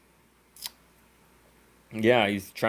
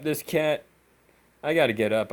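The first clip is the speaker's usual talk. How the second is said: ordinary talk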